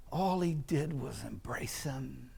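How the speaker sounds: hushed whisper of the embrace; whispered aside to the congregation. Acted. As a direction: drop to a breathy whisper, tender. Father's embrace told softly.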